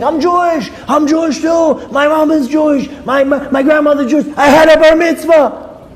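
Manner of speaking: high-pitched imitation of a man shouting; acting out an overexcited stranger's screams; excited screaming. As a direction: blurt each claim as a gleeful yell. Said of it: "he starts screaming".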